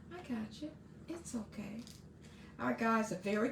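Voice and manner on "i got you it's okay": soft reassurance. Retold or imitated. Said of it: "she was just like".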